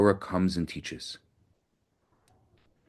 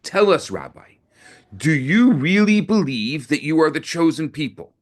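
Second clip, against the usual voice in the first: higher and louder, a mocking sneer; sneering taunt; 7 semitones higher, 5 dB louder than usual. Nazi commander sneering at the rabbi before the crowd. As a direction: taunt him with contempt. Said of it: "He sneered".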